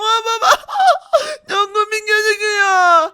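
put-on high, loud childlike squeal; drawn-out high kid voice with laughter; amused little son. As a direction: shriek with mirth as a small kid. Son laughs at father's head.

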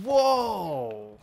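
sudden loud, high whoa of surprise; startled whoa. Real, not acted. Gamer startled mid-fight, sudden loud whoa. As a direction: jolt out a shocked whoa.

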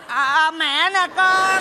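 slow, drawn-out ghostly mother; put-on spirit mother. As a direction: drone it out like a visiting spirit. Resumes fake mother's spirit. (giả giọng hồn mẹ về, kéo dài)

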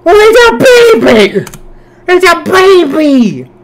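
high and loud, half crying over the puppy; outraged adoring cry. Real, not acted.

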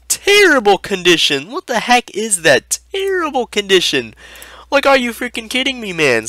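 high-pitched appalled disbelief; incredulous outrage. Acted. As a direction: exclaim it high, as if you can't believe it. Ranting at the fake card's state.

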